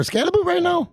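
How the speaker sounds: high-pitched disbelieving question; stunned, squeaky challenge to the pick; incredulous. Real, not acted. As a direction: voice leaps up in disbelief.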